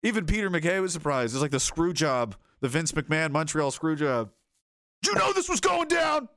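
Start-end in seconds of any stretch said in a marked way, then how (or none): 5.0-6.3 high shouted accusation; mock outraged shout; acted shout. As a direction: yell it like a betrayed man.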